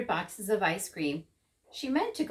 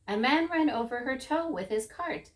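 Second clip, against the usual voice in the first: usual speaking voice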